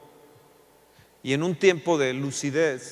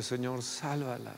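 tearful prayer; 6 dB quieter than usual. Acted. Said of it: "llore y llore, diciéndole".

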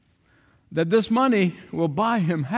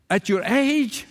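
higher-pitched, incredulous query; surprised question; 5 semitones higher, slower than usual. Retold or imitated. Younger friend questioning his mentor in his seventies, incredulous. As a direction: replay your disbelief, voice lifting.

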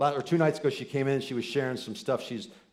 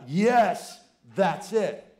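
eager yes; 9 semitones higher, 8 dB louder, much slower than usual. Retold or imitated. Eager reply to wife calling.

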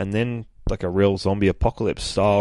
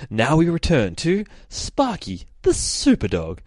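slow, mock announcer voice; TV show announcer; 7 semitones higher than usual. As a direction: announce an old serial, hammed up.

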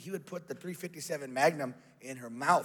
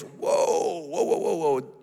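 loud mock-alarmed whoas; alarmed backing off; 6 dB louder, much slower than usual. Brother about to be hit with a pan, loud alarm. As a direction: cry out as if warding off a blow.

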